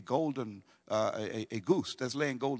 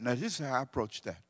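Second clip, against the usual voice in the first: normal talking voice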